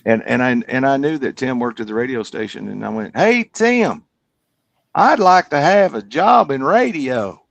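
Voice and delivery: put-on showing off the old Kentucky accent he had; backwoods Kentucky accent. Shown as a demonstration. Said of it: "this is how we talked".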